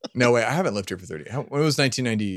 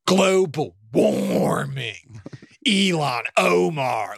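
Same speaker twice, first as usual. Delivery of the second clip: very slow, scornful put-on drawl; mock sneering slur; much slower than usual. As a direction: drag the words out like a slur. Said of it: "always like a slur with them".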